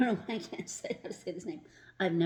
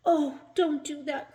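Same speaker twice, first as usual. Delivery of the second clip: high, loud desperate plea; pleading cry; 9 semitones higher, 9 dB louder than usual. A narrator's reading. Rock crying out to stop the boy, high and loud. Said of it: "cried Rock".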